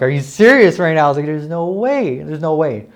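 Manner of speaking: high, stretched-out disbelief, reliving the moment; retold overwhelmed disbelief. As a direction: re-enact a stunned, can't-believe-it reaction. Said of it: "I fucking started crying".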